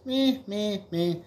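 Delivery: high nasal-free bleating meh; koala bleat. Acted. Koala answers the prostitute with a high bleat. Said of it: "the koala bear goes".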